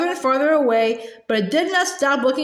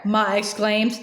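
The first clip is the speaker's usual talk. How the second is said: usual speaking voice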